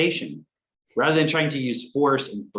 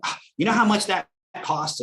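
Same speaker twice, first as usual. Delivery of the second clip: mock scolding parent; 9 semitones higher than usual. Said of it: "a parent who's got a broke mentality".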